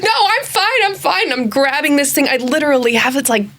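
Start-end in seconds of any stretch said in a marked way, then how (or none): 0.0-1.3 high, breathless insisting she's fine; flustered denial. Retold or imitated. Scene: teen holding a gutted pelican tells a stranger, high flustered insistence. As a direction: brush him off in a panicky rush, up high.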